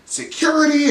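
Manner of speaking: high, loud mock call; screaming for security. Meredith demanding Angie be thrown out, loud mock scream. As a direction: call out for the guards. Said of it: "is screaming and".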